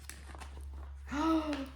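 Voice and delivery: surprised gasp. Real, not acted. Spots the next animal.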